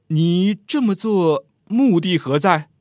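mayor's deliberate, probing voice; probing question. A narrator's reading. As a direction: demand the purpose slowly and weightily. Mayor questions the young man's purpose.

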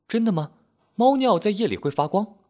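high-pitched, curious questioning. A narrator's reading. Landlady asks Henry about glowing cat urine, curious. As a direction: ask it in wonder, pitched well up. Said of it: "老板娘好奇地问".

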